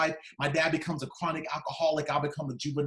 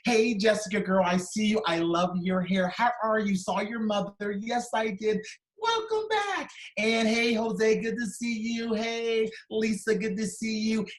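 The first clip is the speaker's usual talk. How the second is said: beaming teacher greeting; 6 semitones higher than usual. Retold or imitated. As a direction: greet every kid like they're the best thing you've seen all day. Teacher greeting her class.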